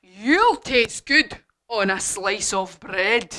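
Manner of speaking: slow, emphatic monster relish; hungry Gruffalo. Acted. Gruffalo to the little mouse, slow hungry relish. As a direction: draw the words out like a hungry monster. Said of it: "the Gruffalo said".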